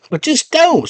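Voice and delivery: high-pitched creature brush-off; little worm voice. Acted. Worm warning the girl, high creature voice. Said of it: "He's like".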